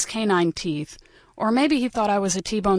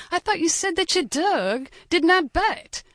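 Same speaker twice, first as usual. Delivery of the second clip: mock French accent, slow and higher; 9 semitones higher than usual. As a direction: ham up a cheesy French inspector, slow and higher. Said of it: "in his cheesy Frenchy accent".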